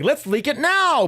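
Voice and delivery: high, eager push to leak it; excited. Retold or imitated. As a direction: cheer it on like a reckless bandmate. Retelling Rumi leaking the song.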